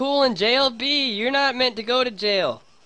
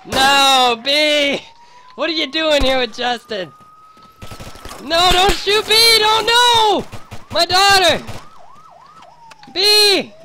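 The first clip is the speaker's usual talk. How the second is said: high, loud panicked cries; frantic yelling; 10 semitones higher, 5 dB louder, slower than usual. Acted. Father yelling as his daughter gets shot, frantic. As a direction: scream for her not to be shot. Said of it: "Sorry I'm yelling at you".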